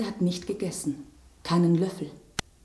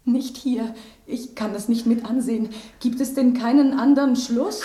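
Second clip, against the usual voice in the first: distressed; 5 semitones higher, 5 dB louder than usual. Acted. Distressed at the coming poisoning.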